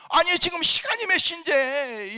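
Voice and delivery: high, loud and slow, each word hammered; slow angry shout. Retold or imitated. Husband demanding to know the time from his late wife. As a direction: drag it out in disbelief and fury.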